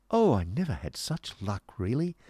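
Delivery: lightly flirtatious delight; coquettish exclamation. Acted. Miss Price flirts after winning at cards, coquettishly. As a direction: gush a little, swooping playfully in pitch. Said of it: "exclaimed coquettish Miss Price".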